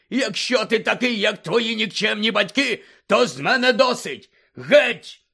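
loud, high, raging uncle; furious shout. Acted. Uncle throwing Harry out, furious shouting. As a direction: bellow him out of the house.

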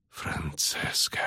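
slow, weak whisper; low, whispered, barely managing to speak; speaking with difficulty. A narrator's reading. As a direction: force the name out faintly, drawn out. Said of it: "с трудом проговорила она".

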